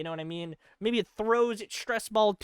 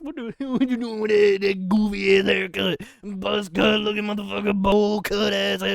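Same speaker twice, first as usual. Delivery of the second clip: a hint of a taunting neighbour, louder; mocking Mars; 5 dB louder than usual. Mars mocks Earth's bad haircut with a loud taunt. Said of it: "Mars looks back at it and is like".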